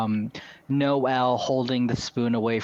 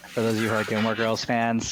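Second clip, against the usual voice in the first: ordinary delivery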